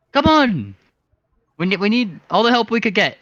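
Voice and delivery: a flighty little rascal egging them on; high excited bird voice. Acted. Coaxing the drunk sailors toward the warehouse, high and eager.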